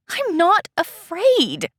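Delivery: high, loud, slow defiance; defiant denial. Acted. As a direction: deny it defiantly.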